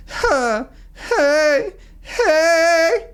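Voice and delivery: high, loud whimpering huh hey hey; cry sound. Shown as a demonstration. Coach demos the cry sound for students. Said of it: "do the cry sound".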